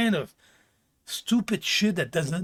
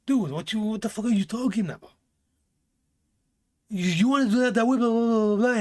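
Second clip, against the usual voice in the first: mock exasperated, higher and faster; exasperated retort; 5 semitones higher, faster than usual. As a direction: brush off their nonsense in a rush. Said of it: "and you're like".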